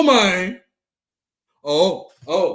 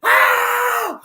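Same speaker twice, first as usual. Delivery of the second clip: ordinary delivery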